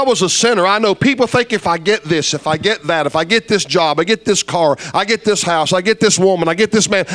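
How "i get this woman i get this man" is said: usual speaking voice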